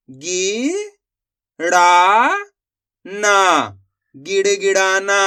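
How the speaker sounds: loud, slow syllable-by-syllable reading, then the whole word. Shown as a demonstration. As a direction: sound out each syllable clearly for learners, then join them. Teacher sounds out Hindi words. (एक-एक अक्षर ज़ोर से और धीरे पढ़ना)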